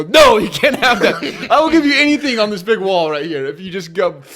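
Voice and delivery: high, loud comic exasperation; exasperated outburst. Retold or imitated. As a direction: yell it like a worker at the end of their rope. Exasperated worker begging kid to leave.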